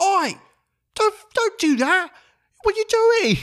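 very high mock-yelled mother voice; yelling British mom. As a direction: impersonate mom scolding from the stands in falsetto. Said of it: "she's yelling at people".

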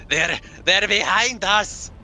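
panicked high shout, slow. Acted. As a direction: yell it in a panic. Panicked character shouting in combat.

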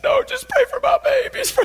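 very high, wailing mock sob; hammed-up tearful plea. Mother begging pastor to pray for her girls, exaggerated crying. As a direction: squeal it like a hysterical begging mother. Mother insists again, acted hysterically.

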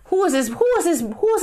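who is this, outraged and shrill; incredulous. Real, not acted. Baffled vlogger asking who sent this, incredulous. Repeating, higher each time.

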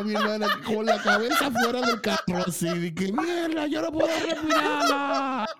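high, laughing mock shout. Retelling his sister's disgust in the car, laughing mock shout.

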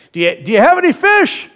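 high, loud call across the water; yelling. Retold or imitated. Jesus on the beach yells to the boat, loud call. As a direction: shout it out to the boat. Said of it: "He yells out to the boat".

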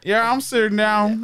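slow put-on Texan; mock Texan, drawn out; Texan accent. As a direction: answer in an unhurried Texan drawl. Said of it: "two Texans".

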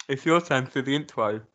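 talking with chocolate in mouth; mouth full. Real, not acted. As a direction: speak round a mouthful of chocolate. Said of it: "I've got chocolate in my mouth".

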